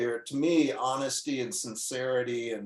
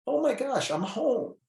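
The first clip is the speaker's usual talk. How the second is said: high, lifted burst of wonder; high, overjoyed exclamation of relief; awed delight; 10 semitones higher than usual. Retold or imitated. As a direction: relive the rush of finding home. Retelling moment of finding belonging.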